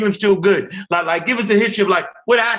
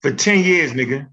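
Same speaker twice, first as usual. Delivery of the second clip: ordinary talk, nothing marked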